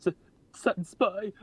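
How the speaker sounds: high, stammering awkward squeak; super awkward voice. Acted. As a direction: stumble over it like a flustered fangirl. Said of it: "super awkward talking voice".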